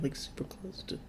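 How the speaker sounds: barely whispered worry. Real, not acted. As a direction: whisper as if not to be heard. Trailing off near danger.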